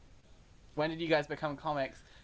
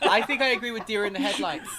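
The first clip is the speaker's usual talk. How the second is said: laughing and louder; 5 semitones higher, 4 dB louder than usual. Real, not acted. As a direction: giggle through the restart. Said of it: "restarts laughing".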